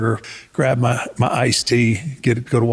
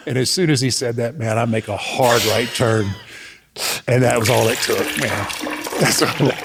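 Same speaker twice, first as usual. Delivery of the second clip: normal talking voice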